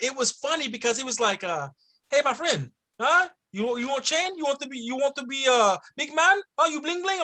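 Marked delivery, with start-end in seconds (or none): 2.1-7.2 higher-pitched mock Middle Eastern street seller; comic Middle Eastern accent, eager sales patter. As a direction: imitate a pushy vendor with a Middle Eastern accent. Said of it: "it was a Middle Eastern origin accent".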